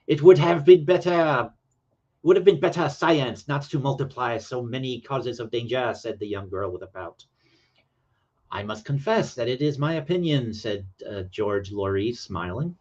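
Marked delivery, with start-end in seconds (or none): 0.1-5.9 hammed-up French accent reading a girl's line; bad French accent. Streamer reads the young girl's line, in a mock French accent. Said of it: "to read with a French accent". 8.5-10.5 higher-pitched mock French accent; bad French accent. Reading George Laurie's reply aloud, in a mock French accent. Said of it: "to read with a French accent".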